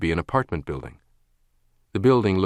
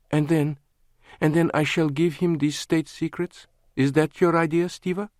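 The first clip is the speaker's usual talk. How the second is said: higher, scornful woman's voice; mocking woman; 9 semitones higher than usual. A narrator's reading. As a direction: ridicule the idea. Lana mocking the plan.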